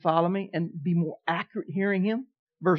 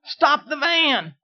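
higher, louder and slow stretched command; drawn-out yell; 7 semitones higher, 4 dB louder, much slower than usual. Retold or imitated. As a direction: drag out the shout like a warning. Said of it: "God had to scream it to me".

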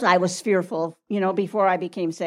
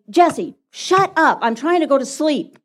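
raised, exasperated sibling complaint; acted irritated brother; 5 semitones higher, 4 dB louder than usual. Brother kept awake by scared Jesse, exasperated telling-off. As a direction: tell him off, drawing out the first words.

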